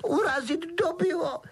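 very high tearful elf voice; Dobby choked up. A narrator's reading. Elf protesting to Harry, choked with tears. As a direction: gulp it out through sobs. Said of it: "zajíkal se skřítek".

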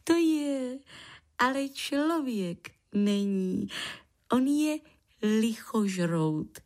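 high creature voice ending in a giggle; proud little creature. Acted. Lichožrout says grandpa is one like him, proud.

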